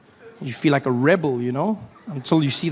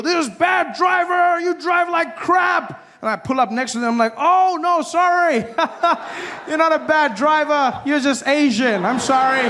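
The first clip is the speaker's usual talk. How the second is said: mock road rage; 9 semitones higher than usual. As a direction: ham up a shouting driver then grovel. Mock road rage then apology.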